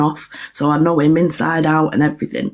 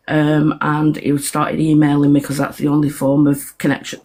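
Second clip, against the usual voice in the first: ordinary talk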